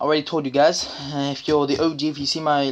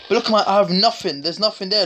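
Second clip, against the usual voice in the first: ordinary talk, nothing marked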